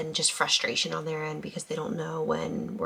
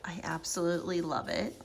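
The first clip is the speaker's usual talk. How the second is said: ordinary delivery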